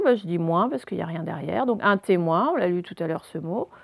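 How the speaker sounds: usual speaking voice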